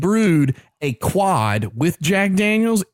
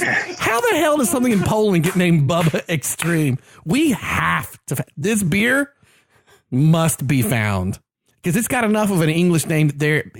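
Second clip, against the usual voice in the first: ordinary delivery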